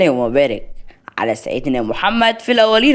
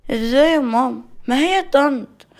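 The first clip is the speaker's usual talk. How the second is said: put-on child talking back to mum; 10 semitones higher than usual. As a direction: play a child contradicting his mother. Voices the child character.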